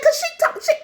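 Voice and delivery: high-pitched, stammering explanation; flustered protest. Retold or imitated. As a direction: sputter it in high-pitched outrage.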